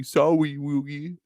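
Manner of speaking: put-on lisping baby-talk Brooklyn voice. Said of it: "I almost made him sound like a baby".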